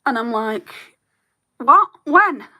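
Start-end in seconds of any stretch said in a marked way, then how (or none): 1.6-2.5 a jolt of surprise, pitched higher and louder; mock shock. Telling a friend about the late-night text, retold startled disbelief. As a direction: bounce back a startled what and when.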